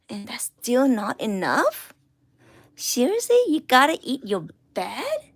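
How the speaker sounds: disbelieving telling-off; exasperated scold. Real, not acted. Exasperated at the pet.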